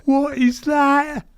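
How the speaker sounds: high, slow frightened question; scared mum. Retold or imitated. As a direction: squeak it out like a mum terrified in the dark.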